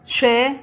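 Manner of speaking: loud, clear announcement of شا; loud teacher naming the letter شا; letter announced. Shown as a demonstration. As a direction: call out شا to the class. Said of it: "حرف الشين محرك".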